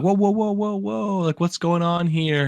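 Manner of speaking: high, slow, pleading whoas with hands up; calm armed men down in a panic; alarmed whoa. Retold or imitated. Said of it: "we're like".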